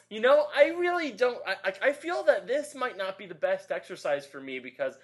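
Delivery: high-pitched mock of a chatty class member; complaining student.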